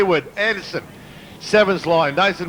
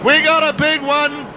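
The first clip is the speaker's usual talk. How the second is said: raised pitch, slowed calling out a crash; excited; 8 semitones higher than usual. Real, not acted. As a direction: shout the crash with rising alarm. Crash called live.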